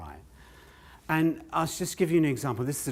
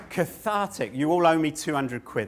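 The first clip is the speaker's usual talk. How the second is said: ordinary talk, nothing marked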